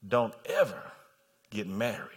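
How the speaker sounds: low, hushed pulpit charge with long pauses; grave, measured word to the congregation; solemn preaching. Real, not acted. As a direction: bring it right down and let each phrase land.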